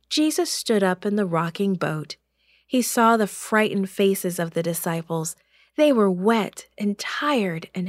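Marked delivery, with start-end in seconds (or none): none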